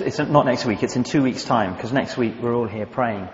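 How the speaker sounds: normal talking voice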